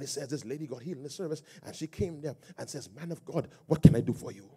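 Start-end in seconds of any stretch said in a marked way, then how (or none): 2.9-4.5 imitated African accent; woman's words in an attempted African accent. Healed lady offering the pastor help, imitated African accent. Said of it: "my best African accent".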